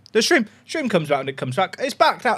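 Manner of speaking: ordinary talk, nothing marked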